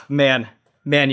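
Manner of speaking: ordinary delivery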